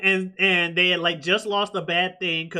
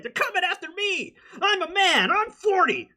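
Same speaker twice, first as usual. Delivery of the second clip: high-pitched rant; 12 semitones higher than usual. Retold or imitated. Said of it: "you just got the guy like".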